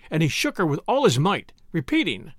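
ordinary delivery, nothing marked

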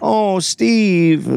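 high, drawn-out call to a dog; acted high-pitched woman's coo; Adriana. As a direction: call the dog over for comfort. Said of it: "calls her dog over".

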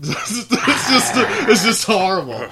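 laughing through the words, high and loud. Real, not acted. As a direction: burst out laughing while talking. Laughing at the grim story.

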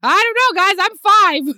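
put-on high squeaky five-year-old; mock small child shrugging; little kid voice. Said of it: "You're like".

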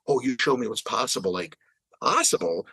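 usual speaking voice

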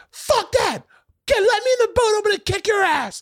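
very high-pitched furious yelling, imitated; hammed-up raging threat from the water; furious Bobby. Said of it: "fucking furious".

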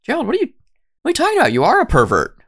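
high, fast, incredulous wife voice; mock the wife, pitched high and exasperated. Wife scolding Gerald for being angry, high incredulous voice. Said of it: "has to be like".

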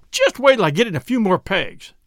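plain reading voice

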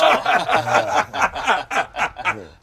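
big loud belly laugh; loud burst of laughter. Real, not acted. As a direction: roar with laughter.

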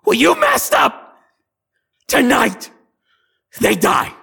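shrieked, high hag threat; gray hag. Acted. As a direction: screech each phrase loudly as a threat. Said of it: "the one in gray looks at you and goes".

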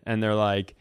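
ordinary talk, nothing marked

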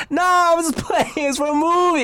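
high, giggling backpedal; laughing nervously. Retold or imitated. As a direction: laugh it off to dodge a fight. Laughing off a fight.

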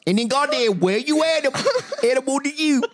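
gibberish Genesis. Pretend. Retelling Genesis in gibberish to the family, hammed-up. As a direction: rattle through a nonsense Genesis, laughing. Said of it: "Can you do Genesis one?".

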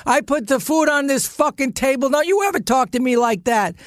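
high-pitched mock rant; retold angry outburst. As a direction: act out an indignant man at the dinner table. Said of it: "And he goes".

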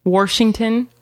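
"Washington" said as Warshington; added R. Retold or imitated. Giving partner an example of old people's speech, added R. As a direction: slip an R into the first syllable of "Washington". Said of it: "warsh instead of wash".

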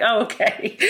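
laughing, a bit sheepish. Retold or imitated. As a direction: give in with a little laugh. Her sheepish retold reply.

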